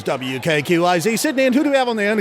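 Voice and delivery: brisk Australian announcer, pitch climbing; Australian radio host. Acted. Radio host greeting a caller live, Australian accent. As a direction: open the show in an Australian accent. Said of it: "he does two different dialects of Australian".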